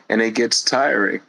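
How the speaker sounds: ordinary talk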